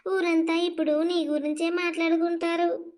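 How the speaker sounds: ordinary delivery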